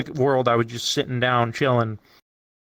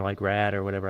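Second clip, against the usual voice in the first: normal talking voice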